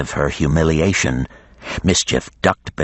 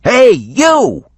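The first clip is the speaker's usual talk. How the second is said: much higher, louder shout; holler; 6 dB louder than usual. A narrator's reading. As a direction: yell up at a giant. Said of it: "he hollered up at the great worm".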